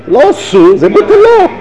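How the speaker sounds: high and loud on the punchline; emphatic exclamation. Real, not acted. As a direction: ring it out like a triumphant reveal.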